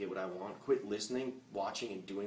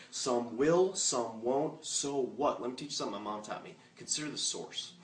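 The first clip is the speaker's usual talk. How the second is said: usual speaking voice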